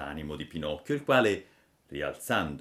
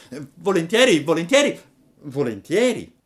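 loud, high repeated yes; eager Pinocchio; 11 semitones higher, 11 dB louder than usual. Acted. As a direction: agree over and over, delighted. (Pinocchio entusiasta, forte)

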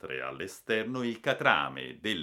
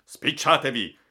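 urgent raised voice, higher and louder; broken-voiced command; 6 semitones higher, 9 dB louder than usual. A narrator's reading. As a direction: call out an order, voice strained with urgency. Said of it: "con voce rotta". (ordine gridato con voce rotta)

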